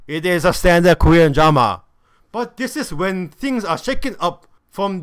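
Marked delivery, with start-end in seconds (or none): 0.1-1.8 louder restatement, firmly falling; emphatic repeat. Real, not acted. Reviewer repeats the verdict on the show, loudly emphatic. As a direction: drive the point home loudly.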